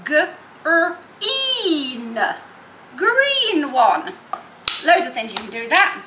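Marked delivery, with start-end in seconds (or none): 0.1-4.1 segment then blend; sounding out green. Shown as a demonstration. Teacher sounding out green for children. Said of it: "What about the".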